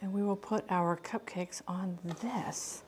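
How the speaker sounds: slow soft whisper. Real, not acted. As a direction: whisper it slowly to yourself. Quiet aside while arranging cupcakes.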